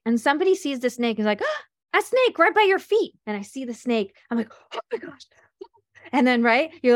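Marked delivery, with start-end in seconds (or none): 1.4-3.1 high alarmed shout at a snake; panicked warning, high and loud; startled cry. Retold or imitated. Someone spotting a snake. 4.7-5.3 breathless scared whisper; hushed gasp of fright; frightened whisper. Retold or imitated. Seeing the snake, frozen.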